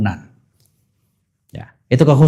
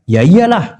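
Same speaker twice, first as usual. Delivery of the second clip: mock obviously; 5 semitones higher, 4 dB louder, slower than usual. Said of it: "artinya kayak".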